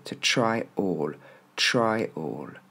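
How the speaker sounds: slow teacher's "try... all"; split into words. Shown as a demonstration. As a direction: TRY ALL as two separate words, slowly. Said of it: "on pourrait l'écrire comme ça".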